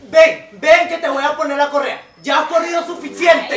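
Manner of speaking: loud high call to the dog; shouting. Acted. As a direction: shout the dog back to you. Owner calls dog to leash.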